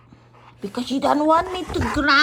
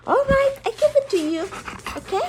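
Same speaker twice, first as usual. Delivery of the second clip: fast high pet voice; 8 semitones higher, faster than usual. Real, not acted. Promising treat to dog.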